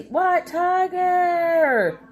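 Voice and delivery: long, high drawn-out greeting to a viewer; happy high call across the room; excited call-out. Real, not acted. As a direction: sing out the name in delight.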